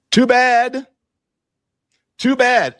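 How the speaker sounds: high sing-song too bad; mock the critics in a high teasing lilt; taunting. Real, not acted.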